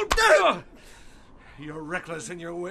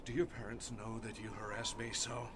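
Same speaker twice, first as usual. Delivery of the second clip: low, very soft threat; quiet menace; 8 semitones lower, 9 dB quieter than usual. Acted. Old man questions the pinned boy, quietly menacing. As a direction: drop low and dangerous.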